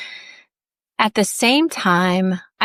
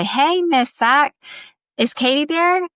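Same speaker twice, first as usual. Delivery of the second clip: put-on a polite girl asking for her friend, pitched up; friend on the phone; 8 semitones higher than usual. Friends calling on family phone.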